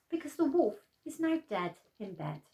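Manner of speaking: ordinary delivery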